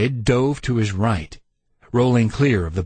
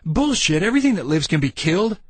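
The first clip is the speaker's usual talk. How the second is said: higher, fast dismissive scoff; defiant scoff; 7 semitones higher, faster than usual. A narrator's reading. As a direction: brush off the warning. Randy's defiant retort.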